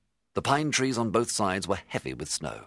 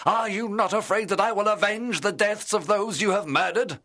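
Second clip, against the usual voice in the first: higher and louder, rising to a furious shout; angry accusation; 13 semitones higher, 4 dB louder than usual. Acted. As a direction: confront the monster with mounting rage. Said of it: "I cried".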